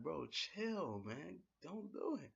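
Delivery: high-pitched mock plea; Cass pleading. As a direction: Cass telling Enzo not to go, high voice. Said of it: "Then Cass was like".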